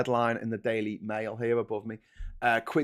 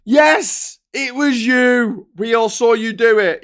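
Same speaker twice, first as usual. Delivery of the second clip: high, very loud accusing yell; exasperated shout; 11 semitones higher, 13 dB louder, slower than usual. Acted. Onlooker answering the feigning killer, exasperated shouting. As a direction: drag out the accusation at full volume. Acted furious reply to feigning culprit.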